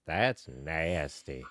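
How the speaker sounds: slow, low drawn-out "That's nasty"; Cleveland Brown impression. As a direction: stretch it slow and low like the cartoon dad. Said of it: "in the words of, of Cleveland Brown".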